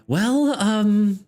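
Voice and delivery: kindly nurse admitting something awkward; generic female voice. Acted. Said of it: "like, generic female".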